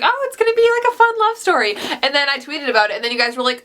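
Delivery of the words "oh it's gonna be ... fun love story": much higher, airy mock-naive voice; naive past self. Reader mocking her own naive expectation, much higher. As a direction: play your clueless earlier self. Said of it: "I just started and I was like".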